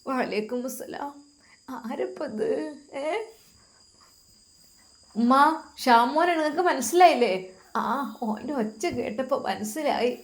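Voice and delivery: put-on an elderly grandma speaking gently from her bed; frail grandmother. Old grandmother answers softly.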